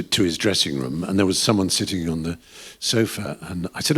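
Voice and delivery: ordinary talk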